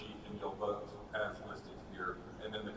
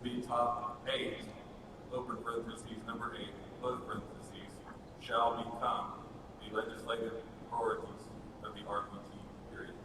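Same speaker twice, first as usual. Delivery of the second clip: dictating. Shown as a demonstration. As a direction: read it to the typist with punctuation. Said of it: "can we just start typing?".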